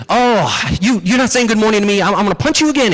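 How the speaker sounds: loud, high, exasperated threat; increasingly mad rabbit. Acted. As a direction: splutter with acted outrage. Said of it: "he's getting more and more mad".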